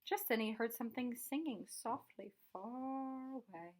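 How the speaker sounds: usual speaking voice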